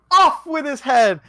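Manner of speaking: high, loud furious royal order; queen's shout. Acted. Queen condemning the card, high furious shout. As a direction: scream the sentence.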